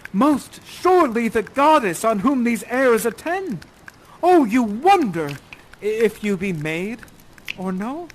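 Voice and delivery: slow, loud awestruck call, easing off; Ferdinand calling out. Acted. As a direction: call out grandly, then soften to a question. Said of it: "You call out to the creature".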